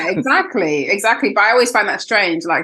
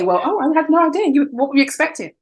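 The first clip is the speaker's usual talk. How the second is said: much higher, put-on amazed colleague; mock surprise; 8 semitones higher than usual. Coworkers feigning surprise, high mock voice. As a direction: play the clueless coworker.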